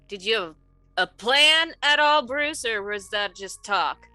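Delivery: ordinary delivery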